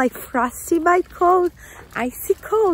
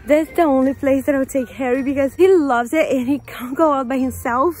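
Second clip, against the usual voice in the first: ordinary talk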